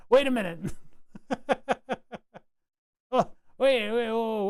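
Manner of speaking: a real double take; sudden surprise. Real, not acted. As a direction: catch yourself, startled. Sudden realization while reading datasheet.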